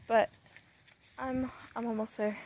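normal talking voice

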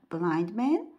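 usual speaking voice

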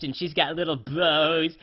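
normal talking voice

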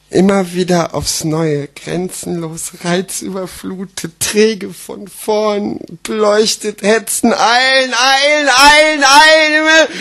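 measured start rising to loud, high urgency; building slam recital. Acted. Slam poet performing his toilet text, urgency building. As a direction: wind it up word by word to a frantic pitch.

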